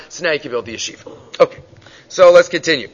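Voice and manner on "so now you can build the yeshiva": lower, softer, gently granting; gentle permission. Retold or imitated. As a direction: let the student go ahead. The Gra gently concludes.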